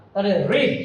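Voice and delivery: রিড with a clear R at the start; teacher models রিড, R sounded; clear R in read. Shown as a demonstration. Teacher to students, modelling রিড with a clear R. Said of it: "এই আর এর উচ্চারণটা এখানে হবে রিড".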